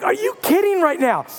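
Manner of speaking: can't believe it; incredulous. Retold or imitated. Pastor still amazed at friend's promotion. Disbelief repeated.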